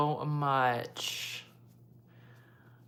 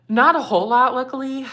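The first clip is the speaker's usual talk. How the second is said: emphatic; 9 semitones higher, 12 dB louder than usual. Real, not acted. Emphasis on few mean comments.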